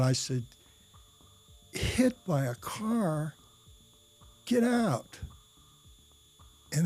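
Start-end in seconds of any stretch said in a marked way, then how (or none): 1.7-5.2 slow, high-pitched incredulous echo; stunned question leaping higher on the exclamation; retold disbelief. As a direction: draw it out, unable to believe it. Retold shocked reaction to news.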